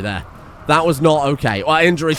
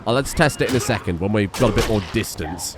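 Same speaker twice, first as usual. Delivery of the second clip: ordinary talk, nothing marked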